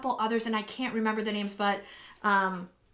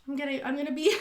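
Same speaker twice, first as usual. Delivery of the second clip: ordinary delivery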